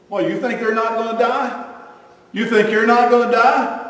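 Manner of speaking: high-pitched mock disbelief; incredulous retort. Preacher answering relatives who avoid death talk, incredulous retort. Acted retort to protesting family.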